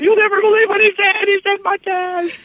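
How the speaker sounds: very high excited squeal; giddy disbelief. Retold or imitated. Caller telling the director about Shatner, giddy squeal. Reliving an excited phone call.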